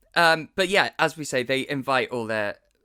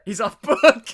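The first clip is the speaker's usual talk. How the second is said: amused; 8 semitones higher, 11 dB louder than usual. Real, not acted. Repeating partner's joke amused.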